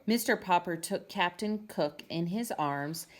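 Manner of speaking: ordinary delivery, nothing marked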